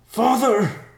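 high, loud anguished scream. A narrator's reading. Riverpaw runs to his crushed father, screaming. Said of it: "Riverpaw screamed as he ran to him".

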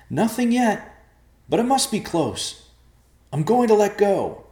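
loud, high call from below; high-pitched yelled report; shouting. Acted. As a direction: shout each phrase up to friends above. Shouting up from inside the hole.

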